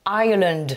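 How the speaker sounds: Ireland with the R sounded after the I; English R sound. Shown as a demonstration. Teacher summing up for learners, English R in Ireland. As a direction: bring out the R in Ireland. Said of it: "avec le son R".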